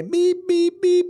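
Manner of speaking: high mock horn beeps; truck horn beeps.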